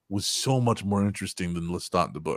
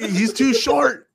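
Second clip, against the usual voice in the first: high, slow, loud whine with the stress on short; mock outraged author; 12 semitones higher, 6 dB louder, slower than usual. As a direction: complain like the indignant author. Mocking author's complaint.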